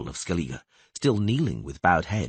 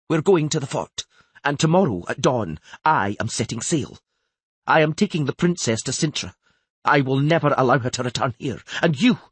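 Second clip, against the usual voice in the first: raised, forceful royal decree; angry commands; 5 semitones higher, 5 dB louder than usual. A narrator's reading. Queen telling the Earl she is taking the princess away, angry commands. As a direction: lay down the law, high and loud. Enraged queen gives orders.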